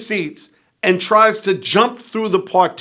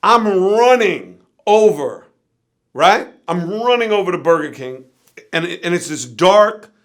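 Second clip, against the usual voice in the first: usual speaking voice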